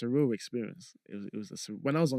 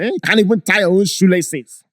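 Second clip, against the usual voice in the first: Doctor Abu accent; 8 semitones higher, 15 dB louder than usual. Shown as a demonstration. Riffing with the host, scolding in the loud Doctor Abu accent. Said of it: "the accent will find it".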